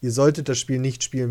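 normal talking voice

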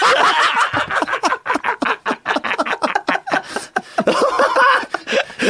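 high-pitched burst of laughter; high laughing shriek. Real, not acted. Teller cracking up at his own impression, high-pitched laughter.

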